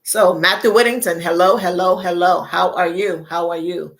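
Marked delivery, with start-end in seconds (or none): none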